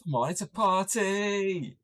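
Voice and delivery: slow, drawn-out high party voice; party host voice. Retold or imitated. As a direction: stretch it out like an eager host. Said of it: "he's like".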